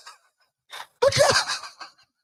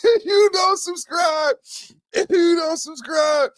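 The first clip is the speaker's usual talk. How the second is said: mock-hype shout; hyped yell; 7 semitones higher, 4 dB louder than usual. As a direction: call it out to the viewers. Hyped subscribe plug.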